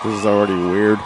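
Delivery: loud burst of laughter; laughing. Real, not acted. Reacting to the clip.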